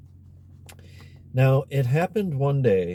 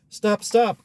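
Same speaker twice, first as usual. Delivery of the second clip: higher, louder frog calling after her; crying out; 12 semitones higher, 5 dB louder than usual. Acted. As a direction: shout stop after the running princess. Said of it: "cried the frog".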